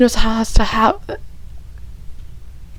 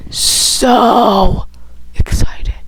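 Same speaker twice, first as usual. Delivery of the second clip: excited whisper; much slower than usual. Real, not acted.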